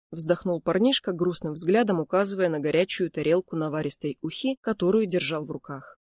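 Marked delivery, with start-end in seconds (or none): none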